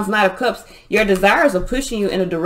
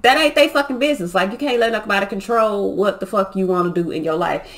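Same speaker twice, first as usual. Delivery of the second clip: ordinary delivery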